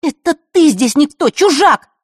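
put-on high, loud angry girl; indignant retort. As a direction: spit it back in outrage. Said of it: "возмутилась Захара". (возмущённо, громко)

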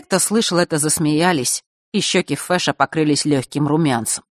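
ordinary delivery, nothing marked

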